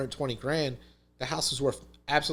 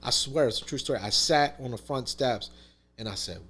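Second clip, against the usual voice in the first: ordinary talk, nothing marked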